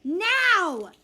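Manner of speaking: loud, high drawn-out yell; yelled order. Acted. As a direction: yell it like an impatient mom. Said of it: "yells my mom".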